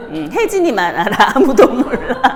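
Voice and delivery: amused, laughter breaking into the words; laughing. Real, not acted. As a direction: talk through a real laugh. Giggles over the unspoken swear word. (웃음을 터뜨리며)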